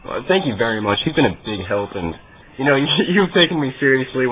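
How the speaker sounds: usual speaking voice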